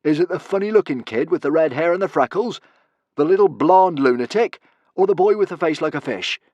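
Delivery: loud, high-pitched mocking questions; single out each prisoner with scornful volume; theatrical bully sizing up captives; taunting villain.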